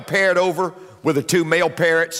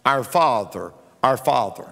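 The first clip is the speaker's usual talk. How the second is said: droning repeated prayer; 6 semitones lower than usual. Retold or imitated. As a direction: mimic a man droning the same prayer endlessly. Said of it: "all day long just says".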